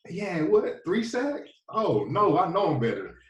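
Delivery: "three sacks?!" with a rising, outraged scoff; mock outrage at a rival getting drafted; incredulous scoff. Scoffing at another player's stats.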